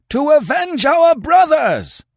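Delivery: very high, loud mob war cry; slow, high-pitched vengeful shout; howled. A narrator's reading. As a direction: howl for vengeance. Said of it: "they howled".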